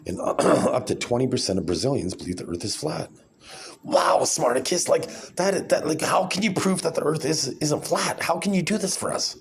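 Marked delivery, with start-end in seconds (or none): 3.8-9.4 mock-eager questioner. Vlogger's imaginary fan asks Smarticus to prove it, high mock awe. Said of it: "I'm gonna be playing different roles here".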